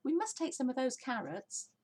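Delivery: high, eager little voice; a small child piping up; small fox. Acted. Smallest fox calling to his dad, high and eager.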